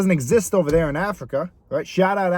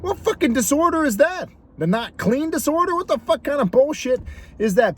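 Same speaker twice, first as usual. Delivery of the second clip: high, scoffing disbelief; incredulous; 9 semitones higher than usual. Real, not acted. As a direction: scoff at it like it's absurd. Mocking the friend's excuse.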